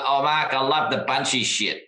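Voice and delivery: put-on German-tinged Australian accent done lightly. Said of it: "I wish I could do his accent 'cause it's this kind of twang of Australia, but with some very strong German undertones".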